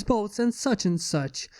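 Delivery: ordinary delivery, nothing marked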